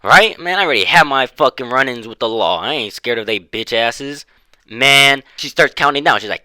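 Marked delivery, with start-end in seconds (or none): none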